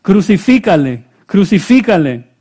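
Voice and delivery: high, loud crowd chant; crying out. A narrator's reading. Priests and officers demanding crucifixion, crying out. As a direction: call it out forcefully as the read-aloud mob. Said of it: "dieron voces diciendo".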